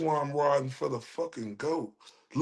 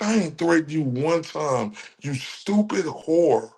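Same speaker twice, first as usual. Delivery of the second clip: ordinary delivery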